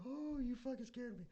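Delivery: high, wailing mock relief after a fright; scared older woman. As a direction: imitate a startled woman doubled over. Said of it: "she's, like, doubled over".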